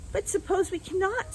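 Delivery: a hint of a young girl's worry; worried girl. A narrator's reading.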